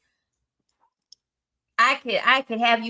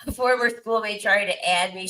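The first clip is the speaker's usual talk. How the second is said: ordinary talk, nothing marked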